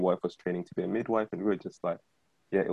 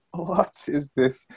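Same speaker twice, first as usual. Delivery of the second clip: higher, slow, drawn-out what is this; mock disbelief; 7 semitones higher, much slower than usual. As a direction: stare at the plate in disbelief. Staring at his breakfast plate.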